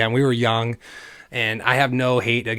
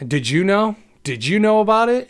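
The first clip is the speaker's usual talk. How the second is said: high, loud, rising demand; urgent confronting; 10 semitones higher, 6 dB louder than usual. Retold or imitated. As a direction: re-enact a shocked confrontation, building on the repeat. Retold confrontation with a friend.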